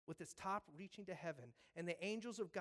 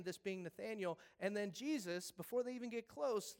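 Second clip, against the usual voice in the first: ordinary talk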